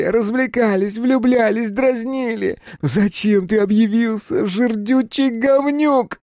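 high, hysterical shouted reproach; screaming accusation, voice pushed very high; furious tirade. A narrator's reading. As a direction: rail at him in an anguished shriek. Kapustin shakes and berates his friend.

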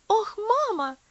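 high loud cry of mama; cried out. A narrator's reading. Lucy bursts in crying out to her mother, loud and high. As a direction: call out to mother in distress. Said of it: "крикнула девочка".

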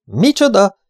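high, loud startled outburst; astonished, pitch shooting up; aghast. A narrator's reading. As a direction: blurt it out in sheer disbelief. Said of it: "hüledezett Rogers".

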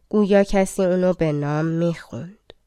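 ordinary delivery, nothing marked

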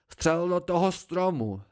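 slow, loud, high yell; roaring. A narrator's reading. Narrator roars at Martin to shoot the tree, slow and loud. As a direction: drag the order out as a bellow. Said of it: "zařval jsem".

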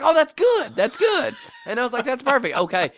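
mock delighted praise; gleeful. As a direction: squeal with delight at the plan. Delighted reaction to kid's lie.

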